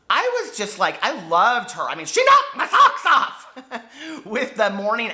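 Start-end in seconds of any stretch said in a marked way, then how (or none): 2.1-5.1 laughing. Real, not acted. Gushing over a favourite book.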